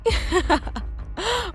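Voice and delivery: high, brief mocking laugh; scornful laugh. Sister scoffs at being called greedy, mocking laugh. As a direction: laugh off the accusation.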